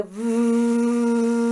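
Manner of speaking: long buzzing V through the lips; sustained V buzz. Shown as a demonstration. Coach models a semi-occluded V exercise, buzzing. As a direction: hold a steady buzzing V. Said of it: "with a kind of V sound".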